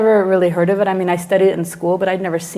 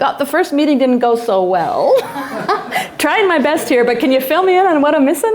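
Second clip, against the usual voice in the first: higher voice, laughing through it; awkward laughing apology; 7 semitones higher, 3 dB louder than usual. Retold or imitated. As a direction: retell an awkward call with nervous laughs. Awkward call to mother, retold.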